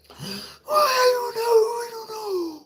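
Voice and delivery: very high slow crying falsetto; hysterical lady. Retold or imitated. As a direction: wail it out like she's losing her mind. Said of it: "I'm doing a type of voice like her".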